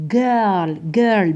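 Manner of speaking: put-on جيرل repeated as "girl", g as in good; correct hard g. Shown as a demonstration. Teacher repeats the corrected word for students, hard-g pronunciation demo. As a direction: model جيرل with a hard initial g. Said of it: "بصح في هذه الحاله ننطقوها".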